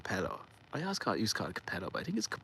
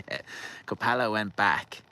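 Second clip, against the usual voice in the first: ordinary talk, nothing marked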